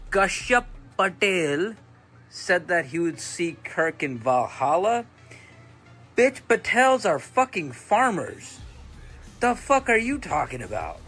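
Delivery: ordinary delivery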